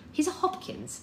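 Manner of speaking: high, sharp retort; high, impatient cut-in; snapping. A narrator's reading. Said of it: "snapped Lilith".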